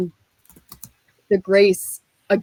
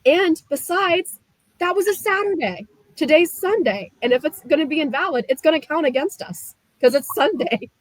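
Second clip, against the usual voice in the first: insistent pleading; 8 semitones higher than usual. Retold or imitated. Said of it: "And I said".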